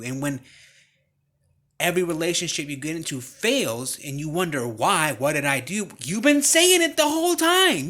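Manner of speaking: rising from loud to full-throated high shouting; building sermon shout. Real, not acted. Preacher scolds his listeners at the top of his voice, shouting. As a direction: preach it harder and harder until you're yelling.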